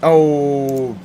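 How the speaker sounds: ordinary talk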